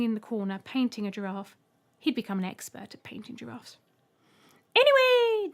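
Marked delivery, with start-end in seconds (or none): 4.7-5.5 very high, loud bark; loud alien shout. A narrator's reading. Alien taking charge of the room, high loud shout. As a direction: cut in with a shout. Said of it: "the middle Trembler shouted with an uncharacteristically loud tone".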